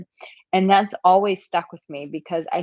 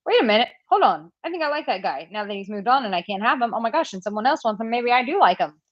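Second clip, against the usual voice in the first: fast, higher-pitched mock second thoughts; woman having second thoughts; 4 semitones higher, faster than usual. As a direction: rattle it off like a suddenly interested woman. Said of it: "sometimes women are like".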